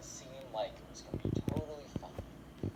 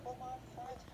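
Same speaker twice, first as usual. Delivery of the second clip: high, lightly voiced protest; a hint of a whining son cut off; whiny kid then mom; 9 semitones higher, 4 dB quieter than usual. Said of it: "Ben's like".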